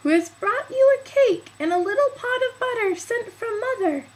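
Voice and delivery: put-on very high, loud tearful child voice; the wolf mimicking a little girl; high teary fake child voice; counterfeit girl voice. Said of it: "counterfeiting her voice".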